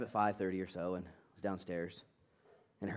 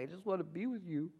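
put-on higher, softer pleading child voice; small, high mock-crying voice; imitated crying child; 8 semitones higher, 4 dB quieter than usual. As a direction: mimic a tearful kid pleading. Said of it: "he started crying".

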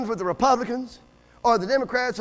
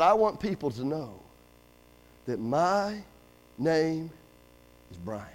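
low, soft, with pauses between phrases; quiet confession; 8 semitones lower, 7 dB quieter, slower than usual. Retold or imitated. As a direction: let the boy's wish drop low and hushed, haltingly. Boy's quiet confession of his wish.

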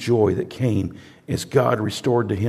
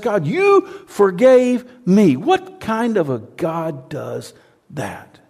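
slow, high, breaking with awe; astonished gratitude; awed wonder; 8 semitones higher, slower than usual. Real, not acted. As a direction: let the wonder slow you down. Awestruck prayer.

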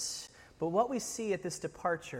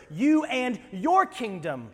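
slow, louder, higher, leaning on each word; mock a self-centred king, higher and drawn out; selfish Saul; 6 semitones higher, 5 dB louder, slower than usual. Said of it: "you hear Saul's selfish words".

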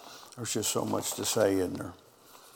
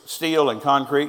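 ordinary talk

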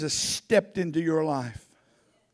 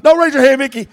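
playful call-out; 5 semitones higher, 16 dB louder, faster than usual. Real, not acted. Teasing a congregant.

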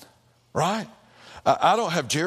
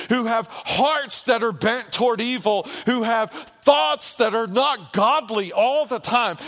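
higher and louder, ringing pulpit cadence; rising sermon climax, near-chanted; preaching at full force; 11 semitones higher, 5 dB louder than usual. Acted. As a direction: preach it out, half-singing the lines. Preaching climax on Ninevites and grace.

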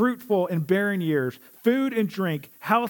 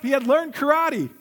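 ordinary talk

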